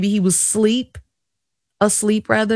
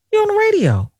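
high imitation of dad; 12 semitones higher, faster than usual. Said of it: "He said".

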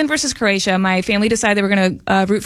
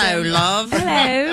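put-on British accent; slower than usual. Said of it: "your British accent?".

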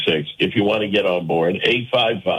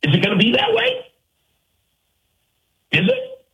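challenging question; 12 semitones higher than usual. Real, not acted. Challenging imagined Palestinian listener.

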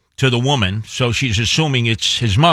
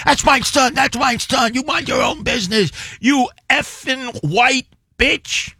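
high-pitched imitation of an angry mother yelling; mock furious outburst; 12 semitones higher than usual. A stranger's mother screaming at the wife on the street, mimicked angry yelling.

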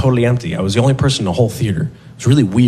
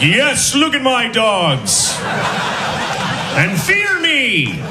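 high, slow gloating victory cry; triumphant boast; 11 semitones higher, slower than usual. Retold or imitated. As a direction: crow like a conquering hero. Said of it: "I was like".

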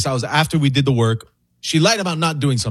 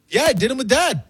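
higher, lightly voiced five-year-old girl; little daughter; 11 semitones higher than usual. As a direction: pitch up into a sly little kid.

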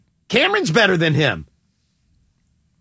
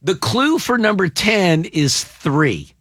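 slow, deliberate repetition; slow, emphatic explanation; spelling it out; slower than usual. Real, not acted. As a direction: lay it out slowly for someone confused. Repeats clue for confused cohost.

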